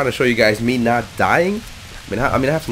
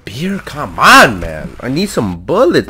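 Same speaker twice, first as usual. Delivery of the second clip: drawn-out, louder, exasperated whine; a little annoyed; 4 semitones higher, 8 dB louder, much slower than usual. Real, not acted. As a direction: complain with stretched, swooping stressed words. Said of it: "Now I'm getting a little annoyed".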